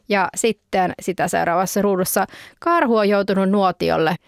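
plain reading voice

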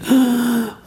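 high sharp gasp of awe; surprised gasp. Retold or imitated. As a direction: gasp in wonder like a little girl. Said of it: "She went".

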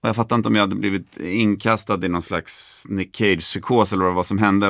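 normal talking voice

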